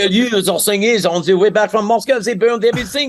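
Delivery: high, hammed-up French accent. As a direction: tell it as a cartoonish French Napoleon. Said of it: "in that role with his French accent".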